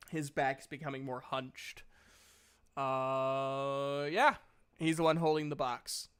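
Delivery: normal talking voice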